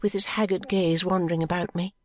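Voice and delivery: ordinary delivery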